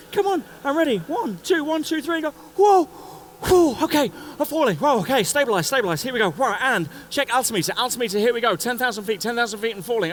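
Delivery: high, loud excited running commentary; freefall self-talk. Retold or imitated. First-time skydiver coaches himself through freefall, high and excited. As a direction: talk yourself through the fall. Narrating his own freefall.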